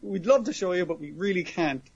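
mock-apologetic announcer retelling; light commentator voice, emphatic then easing off. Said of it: "the commentator's like".

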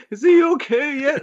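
anxious relative. Retold or imitated. Suzue asking from her computer about him, high and fretful. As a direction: fret over him from the desk.

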